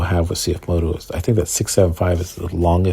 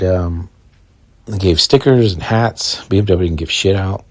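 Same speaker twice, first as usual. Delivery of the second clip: usual speaking voice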